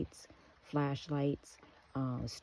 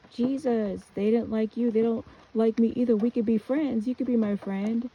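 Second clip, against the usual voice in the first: put-on high, loud child voice; high-pitched childlike appeal, pushed louder; little girl pleading; 9 semitones higher, 6 dB louder than usual. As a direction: plead with Jesus like a small, hurt kid. Retells her child self pleading.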